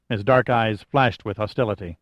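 ordinary delivery, nothing marked

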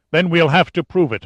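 a harsh, impatient snap at a broken man; ruthless snap. A narrator's reading. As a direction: bite the words off without mercy. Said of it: "Ombrikov snapped ruthlessly".

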